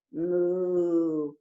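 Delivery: low mock moo; cow moo. Cow moos in story.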